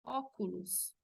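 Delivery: "óculos" with open first o, "AW-koo-loos". Shown as a demonstration. Teacher models example word, "óculos" with open O. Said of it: "con la tilde tiene sonido de O".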